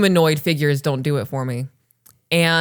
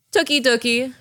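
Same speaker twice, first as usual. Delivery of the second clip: put-on child voice; 8 semitones higher than usual. A narrator's reading. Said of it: "Princess said, smiling just slightly".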